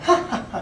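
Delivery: breathy, high mock chuckle; imitated laugh. Mimicking a colleague's doubting laugh, breathy high chuckle. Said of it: "you'll get that".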